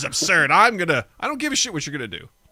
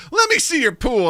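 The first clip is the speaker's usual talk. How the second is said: loud, higher demand; demanding pimp; 8 semitones higher, 5 dB louder than usual. Retold or imitated. Pimp demanding to see the pool, loud and high. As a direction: bark it at the clerk. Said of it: "He's like".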